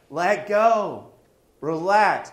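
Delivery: urging call. Retold or imitated. Coaching Matt, calls out.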